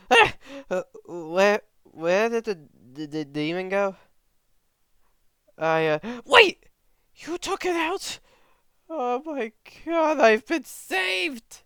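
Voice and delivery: hammed-up scared boy voice; confused coward. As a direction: wake up flustered then overjoyed. Zenitsu waking up confused.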